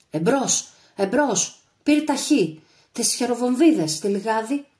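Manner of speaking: loud, high battle commands; shouted orders. A narrator's reading. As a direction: bark orders over gunfire. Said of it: "απαντούσε φωναχτά ο Άγρας". (φωναχτές διαταγές στη μάχη)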